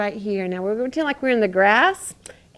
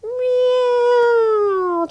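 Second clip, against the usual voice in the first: high, drawn-out cat meow; 12 semitones higher, 5 dB louder than usual. Shown as a demonstration. Teacher meowing like a cat for a child in cat-cow. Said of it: "like a mad cat".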